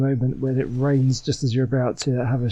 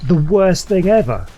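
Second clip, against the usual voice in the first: loud exasperated wail; loud exasperated complaint; loud lament; 4 semitones higher, 8 dB louder than usual. Real, not acted. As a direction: moan it loudly in mock despair. Fight going badly.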